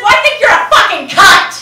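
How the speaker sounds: high, loud, spitting rage at mom; teen yelling at her mother; furious yell. Retold or imitated. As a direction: scream it back at her.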